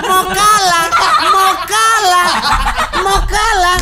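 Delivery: high, loud singsong teasing through laughter; mock him while laughing; laughing taunt. Real, not acted. Teasing a rival as scared. (ejekan sambil tertawa)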